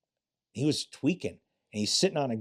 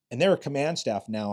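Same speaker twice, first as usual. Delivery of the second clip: ordinary talk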